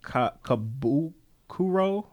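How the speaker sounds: halting, questioning attempt at "Kabukuro"; unsure pronunciation. Real, not acted. Host attempting an unfamiliar artist name, unsure pronunciation. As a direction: sound out "Kabukuro" slowly, breaking after the first syllable. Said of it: "I don't know how to pronounce this".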